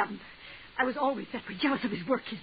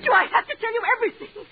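much higher and louder, near a shriek; desperate outburst; 12 semitones higher, 6 dB louder than usual. Acted. Woman shouting at the clerk who won't help, desperate outburst. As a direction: burst out at him in desperation.

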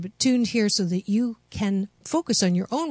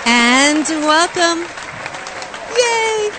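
excited welcome; 8 semitones higher, 6 dB louder, much slower than usual. Real, not acted. Host greets listeners back on air.

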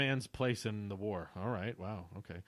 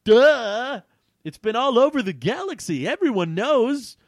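much higher, loud, scornful shout; yelling; 11 semitones higher, 12 dB louder than usual. A narrator's reading. Weston yells at Quintar, loud scornful shout. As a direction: belt it out like everyone should know. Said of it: "Weston yelled".

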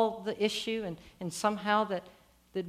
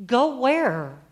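mildly raised, incredulous appeal; crying out; 3 semitones higher, 4 dB louder than usual. Acted. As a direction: call after someone walking away. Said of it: "host of heaven kind of cries".

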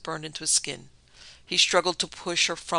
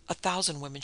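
plain reading voice

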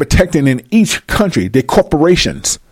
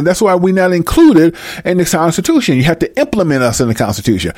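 ordinary talk

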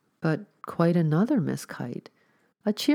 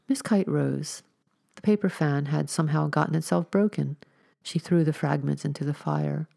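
ordinary delivery, nothing marked

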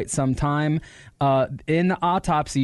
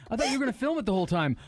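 mock rider; 7 semitones higher, faster than usual. Maimed rider voiced.

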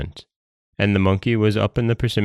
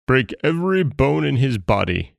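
raging Dragon King; 6 semitones higher than usual. A narrator's reading. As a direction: order the punishment as an outraged king. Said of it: "he cried".

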